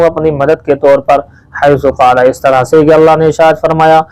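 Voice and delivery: ordinary talk, nothing marked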